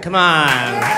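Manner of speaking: ordinary talk, nothing marked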